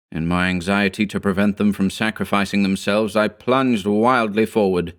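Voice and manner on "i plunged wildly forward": plain reading voice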